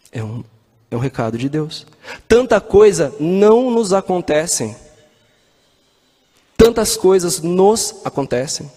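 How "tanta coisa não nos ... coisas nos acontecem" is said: emphatic sermon line. Acted. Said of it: "preaching emphasis".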